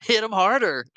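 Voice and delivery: playful high-pitched urging; kids cheering on. Retold or imitated. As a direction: join in as an egging-on kid. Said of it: "and the kids going".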